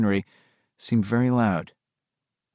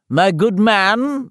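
much higher, slow posh butler voice; Toodles the Butler; 10 semitones higher, 5 dB louder, much slower than usual. Acted. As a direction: play a pompous English butler. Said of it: "in his Toodles the Butler voice".

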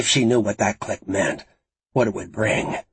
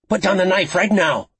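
high, loud sharp command; barked order; 9 semitones higher, 5 dB louder than usual. A narrator's reading. Jess orders Lucy to drop the knife, barking. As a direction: bark it as a police order. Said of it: "Jess barked".

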